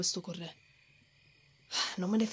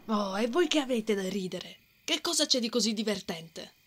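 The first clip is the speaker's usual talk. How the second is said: ordinary talk, nothing marked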